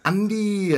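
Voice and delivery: "Andy" high and loud with a "ya" tail; Welsh Andy. Shown as a demonstration. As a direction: belt out "Andy" in Welsh style, drawn out to "ya". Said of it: "There's a y at the end".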